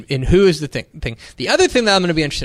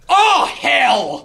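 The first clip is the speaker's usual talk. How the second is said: high, drawn-out mock yell; coach's outraged yell; 9 semitones higher than usual. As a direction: yell like a coach getting bad news. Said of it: "hear one coach yell".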